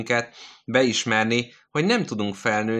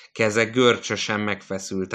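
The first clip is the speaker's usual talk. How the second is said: ordinary delivery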